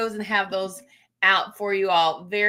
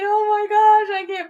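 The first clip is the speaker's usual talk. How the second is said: high and louder, near tears; overjoyed squeal; 10 semitones higher, 4 dB louder than usual. Real, not acted. Vlogger greeting newlywed viewers, squealing with joy. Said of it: "I'll start crying".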